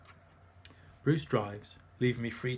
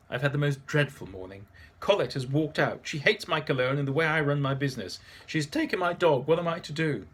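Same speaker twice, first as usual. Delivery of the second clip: higher, louder dramatic lament; distressed Antoine; 3 semitones higher, 6 dB louder than usual. Acted. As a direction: wail about his woes like a wounded artist. Antoine complains of dreadful morning.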